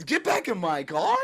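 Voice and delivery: a rising, disbelieving repeat; incredulous echo. Retold or imitated. He repeats the cop's order back, incredulous.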